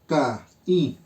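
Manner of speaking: "Ca, I" as two separate low beats; teacher breaking the vowels of "Ca, I" apart; split into syllables. Shown as a demonstration. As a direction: clear pause between "Ca" and "I". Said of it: "la I está acentuada".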